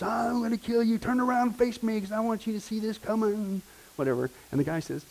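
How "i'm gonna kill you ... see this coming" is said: raised-pitch mock yell of a threat; a retold threat shouted from the porch. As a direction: call out a threat in a higher pitch, half-acted. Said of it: "he's yelling from the porch".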